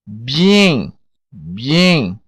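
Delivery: bien said "bee-EN"; bien with a clear "ee" glide; bien, "ee" into a nasal "en"; Creole word. Shown as a demonstration. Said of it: "o Y tem o mesmo som de I".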